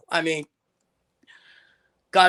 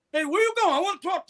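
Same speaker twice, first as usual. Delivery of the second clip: retold boxer calling out; 11 semitones higher, faster than usual.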